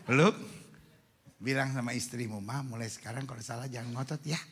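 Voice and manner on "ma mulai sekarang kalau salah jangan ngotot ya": soft, low coaxing; gentle husband. Shown as a demonstration. Modelling a husband's words to his hugged wife, soft and gentle. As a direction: coax her quietly and tenderly.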